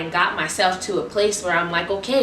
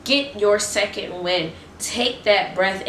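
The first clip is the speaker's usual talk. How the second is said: ordinary talk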